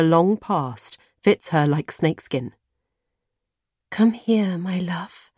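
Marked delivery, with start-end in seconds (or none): 3.9-5.3 breathy luring whisper; siren's whisper. Acted. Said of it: "she whispers in her siren's voice".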